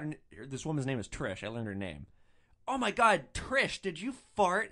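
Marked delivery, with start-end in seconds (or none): 2.7-4.7 loud, high, slow shocked question; shocked boyfriend. Retold or imitated. Boyfriend asking his girlfriend if she farted, loud and shocked. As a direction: sound stunned at what your girlfriend did. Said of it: "He's like".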